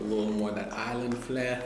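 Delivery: a light, clumsy put-on Caribbean island accent; bad Caribbean accent. Vlogger pitching a Caribbean cookbook, trying a bad Caribbean accent. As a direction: slip into a lightly faked Caribbean lilt. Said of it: "that was a bad accent".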